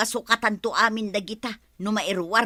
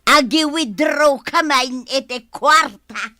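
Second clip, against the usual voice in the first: loud call to Badong; loud boastful announcement; 5 semitones higher, 9 dB louder, slower than usual. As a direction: call out loudly across the yard. Calling out to Badong.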